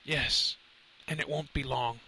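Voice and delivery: soft, faint professor voice; shallow breaths. A narrator's reading. Fading professor answering Lenny, soft shallow breaths. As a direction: answer weakly, on little breath. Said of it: "he said with shallow breaths".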